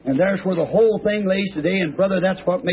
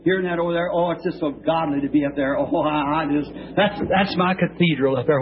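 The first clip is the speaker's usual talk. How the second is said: usual speaking voice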